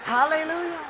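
beaming, high Hallelujah called out; loud hallelujah greeting. Retold or imitated. Pastor greeting the church potluck with a loud, high hallelujah. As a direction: sing out Hallelujah as you walk in.

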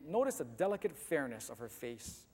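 ordinary delivery, nothing marked

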